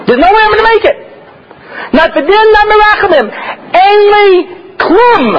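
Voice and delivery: high, pleading cry of a man who has lost; despairing sinner. Pretend. Sinner before the day of reckoning, lamenting high. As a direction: wail it like you're bound for the poorhouse.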